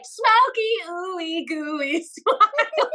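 sing-speak it like a funky hook, giggling; smoky and gooey. Shown as a demonstration. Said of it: "it's just like".

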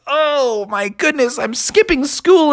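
high, rising cartoon dismay; alarmed. Froggy was seen skipping school, alarmed. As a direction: fret like a frog caught out.

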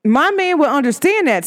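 ordinary delivery

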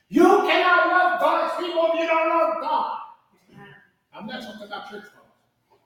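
ordinary delivery

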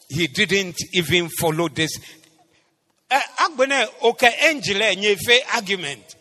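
loud, insistent sermon delivery; forceful preaching. Real, not acted. Preacher telling church about Abraham's promise, forceful preaching. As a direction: preach it loudly to the congregation.

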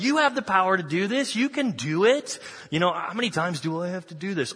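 exasperated, pitched well up, pushing the disciples; a mock-frustrated teacher urging them on; frustrated Jesus. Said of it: "he's like".

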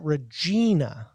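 "Regina" with an EE in the stressed middle; mistaken pronunciation. Shown as a demonstration. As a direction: say "Regina" as ruh-JEE-nuh. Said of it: "I would have pronounced that".

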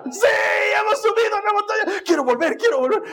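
high, shouted exhilaration; acted giddy excitement. Preacher reliving the joy after his first coaster, giddy shouting. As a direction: gush like a rider begging for another go. Acting out thrill after the ride.

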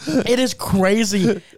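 usual speaking voice

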